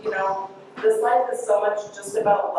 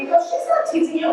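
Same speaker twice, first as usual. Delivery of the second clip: higher-pitched imitation of Mom; mom dismissing complaint; 9 semitones higher than usual. Mom to complaining daughter, imitated high-pitched dismissal. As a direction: voice a mother waving off a tattle. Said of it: "my mom would look at her".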